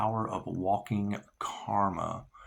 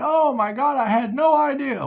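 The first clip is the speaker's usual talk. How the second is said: mock surprise; 13 semitones higher, 6 dB louder than usual. Mocks others' fake surprise.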